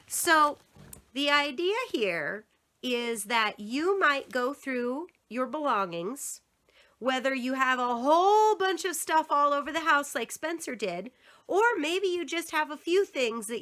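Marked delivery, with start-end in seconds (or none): none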